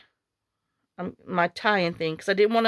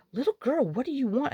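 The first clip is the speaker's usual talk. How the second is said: higher, lilting pet-talk voice; cooing at an animal; 5 semitones higher than usual. Retold or imitated. Alan asking a baby goat what she wants, imitated cooing. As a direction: coo it sweetly, imitating someone talking to a baby goat.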